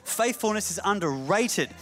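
building to high, ringing sermon delivery; fervent pulpit climax; impassioned preaching. Real, not acted. Preaches at rising force.

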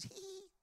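high, soft tittering "hee hee"; tiny squeaky mock giggle; mimicked giggle. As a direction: imitate a girlish giggle.

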